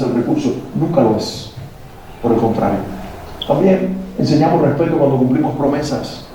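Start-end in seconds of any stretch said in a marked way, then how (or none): none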